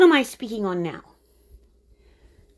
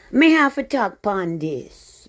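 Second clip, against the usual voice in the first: playful put-on Caribbean lilt; light mock Caribbean accent; fake Caribbean accent; 5 dB louder than usual. Shown as a demonstration. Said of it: "me is my fake, my fake Caribbean accent".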